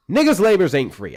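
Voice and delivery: shouted punchline to the chat; loud joking outburst. Real, not acted.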